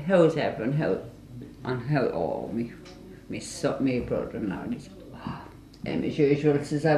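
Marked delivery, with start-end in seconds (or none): 0.1-4.6 low, soft, halting phone-call imitation; Paul Dennis. Imitating the brother-in-law on the phone to the family, low halting mumble. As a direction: imitate a man's quiet, pause-filled phone manner. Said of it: "Paul Dennis would be on the phone".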